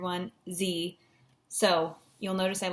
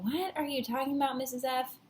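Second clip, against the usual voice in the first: lightly voiced student, pitched up; higher mock-confused query; puzzled student; 5 semitones higher, 3 dB quieter than usual. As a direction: imitate a baffled pupil questioning the teacher. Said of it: "If you're thinking".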